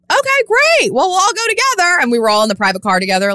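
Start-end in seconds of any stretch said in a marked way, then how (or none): 0.1-2.0 much higher, bright fake-cheery reply; high put-on cheerfulness, hammed-up; mock chirpy. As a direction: act falsely delighted. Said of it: "She's like".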